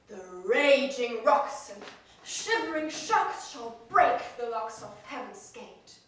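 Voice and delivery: booming heroic declamation; lofty ranting. Acted. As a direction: rant it like a stage tyrant. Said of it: "That was lofty".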